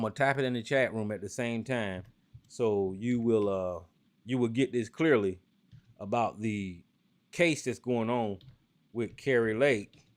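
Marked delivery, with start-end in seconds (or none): 2.5-10.0 slow and deliberate, spelling it out; slow it right down so he gets every word; said slowly. Shown as a demonstration. Telling Sarge in the chat room, slow and deliberate. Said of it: "I'm gonna say this slowly".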